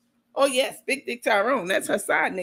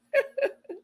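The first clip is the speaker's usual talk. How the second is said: high laugh; 3 dB quieter than usual. Real, not acted. Laughing at photo.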